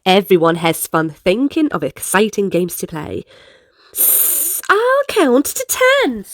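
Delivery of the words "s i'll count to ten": higher, starting with a hiss; hissing snake. Acted. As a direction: let the s hiss before the words like a snake. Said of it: "says Snake".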